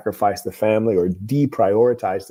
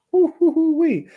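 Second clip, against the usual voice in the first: high whoop; 3 dB louder than usual. Real, not acted. Reacting to the confession.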